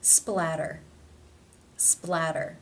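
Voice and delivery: "Splatter" with its T tapped like a D; flap T. Shown as a demonstration. Teacher showing T between vowels, flapped in "Splatter". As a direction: tap the double T of "Splatter" into a D sound. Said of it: "the flap tap T or the D sound".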